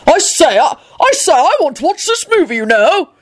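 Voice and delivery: put-on high, stammering old gent; pompous bellow. A narrator's reading. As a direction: bluster like an affronted aristocrat. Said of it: "Richard bellowed".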